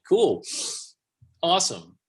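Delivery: sarcastic forced cheer; mock enthusiasm. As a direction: pretend to be impressed. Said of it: "I'm like".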